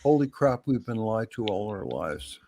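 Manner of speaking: ordinary talk, nothing marked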